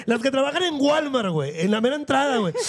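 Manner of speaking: normal talking voice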